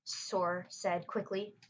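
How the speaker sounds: ordinary delivery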